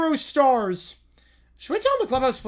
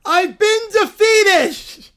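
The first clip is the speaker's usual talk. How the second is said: loud, slow hammed-up cry of defeat; dramatic defeat wail; 6 semitones higher, 7 dB louder, much slower than usual. [name] wails to the arena after one poke, hammed-up and loud. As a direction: fall over and wail it to the crowd.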